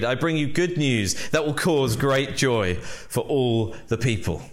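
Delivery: reading the verses aloud with lifted weight; scripture reading. Acted. Pastor reading the angel's words to the congregation, reverent. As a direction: read the Bible passage to the church.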